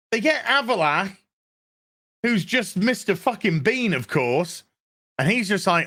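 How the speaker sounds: ordinary delivery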